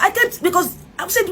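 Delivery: ordinary delivery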